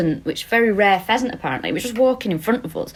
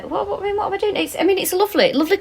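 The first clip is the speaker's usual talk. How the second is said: high squeak; 11 semitones higher, faster than usual. Real, not acted. Flustered, voice jumps very high.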